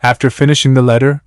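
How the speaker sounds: usual speaking voice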